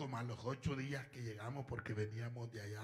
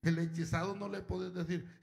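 ordinary talk, nothing marked

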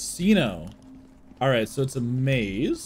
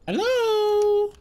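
very high, drawn-out hello; playful falsetto greeting; 4 dB louder than usual. Pretend. Streamer greeting a would-be friend, playful falsetto. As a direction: sing out a squeaky-high hello.

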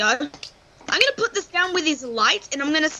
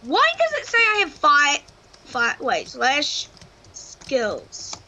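ordinary talk

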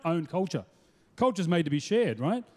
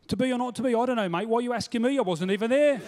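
put-on higher-pitched Stratford local's accent; everyday Stratford accent, pitched up and questioning; Stratford-upon-Avon accent; 4 semitones higher than usual. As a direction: speak Shakespeare as a baffled Stratford local. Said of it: "They speak like this".

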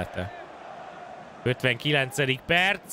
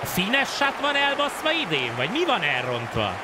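frustrated gamer; 7 semitones higher than usual. Real, not acted. Frustrated at the controls.

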